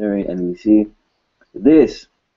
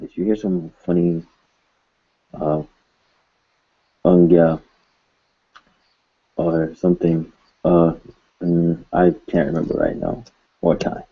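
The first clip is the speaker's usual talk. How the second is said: usual speaking voice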